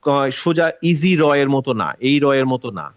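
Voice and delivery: ordinary talk, nothing marked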